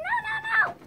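high, loud frantic no; panicked no. Acted. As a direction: cry out in panic. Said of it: "she said".

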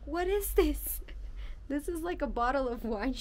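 sudden high-pitched "what is this?"; surprised. Real, not acted. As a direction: react with real surprise at the glass. Surprised by huge wine glass.

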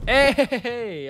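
high, loud alarmed calls; startled shouts. Real, not acted. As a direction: blurt it caught unready. Caught off guard in game.

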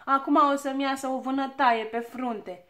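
loud, aggrieved wail; shouted complaint. A narrator's reading. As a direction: complain at full voice about the injury. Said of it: "începu ea să strige". (văicăreală cu glas tare)

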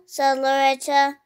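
usual speaking voice

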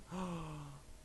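soft, drawn intake of breath; long anxious gasp; horrified gasp. Real, not acted. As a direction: gasp quietly in dread. Dread at new area.

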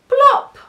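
short, punchy high-pitched plop; high loud plop. A narrator's reading. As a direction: pop it out like something landing. Hat lands.

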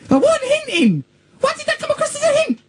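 high-pitched squeal of denial; indignant protest. Real, not acted. [name] denies to Jonathan that he hinted, indignant squeal. Protesting after accused of hinting.

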